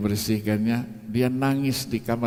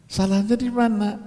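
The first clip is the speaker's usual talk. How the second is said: questioning voice; 9 semitones higher than usual. Retold or imitated. Asking the cause, quoted.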